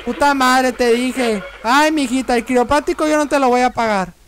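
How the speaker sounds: normal talking voice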